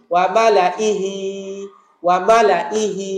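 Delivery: slow, careful ওয়া মালা ইহি for students to repeat; repeat-after-me recitation, slow; slow model recitation. Shown as a demonstration. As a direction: say ওয়া মালা ইহি slowly, spacing each syllable. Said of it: "বলুন আমার সাথে".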